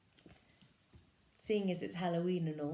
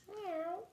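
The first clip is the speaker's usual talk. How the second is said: 1 cat meow; 11 semitones higher, 6 dB quieter than usual. Acted. Cat sound in story.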